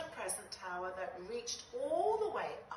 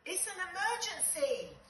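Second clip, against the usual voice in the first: urgent; 9 semitones higher than usual. Acted. Bug alarmed, urgent news.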